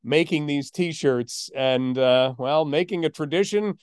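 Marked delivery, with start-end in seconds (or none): none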